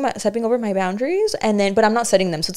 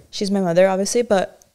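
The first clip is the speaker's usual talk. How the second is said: ordinary talk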